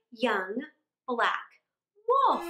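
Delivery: plain reading voice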